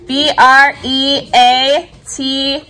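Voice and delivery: deliberate teacher spelling with spaced letters; slow spelling. Shown as a demonstration. Teacher spells word for class.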